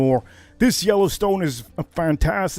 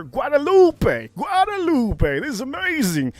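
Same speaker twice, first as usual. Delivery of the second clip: excited; 9 semitones higher than usual. Real, not acted. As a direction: savor Guadalupe as if it were a treasure. Hyping the bottle name.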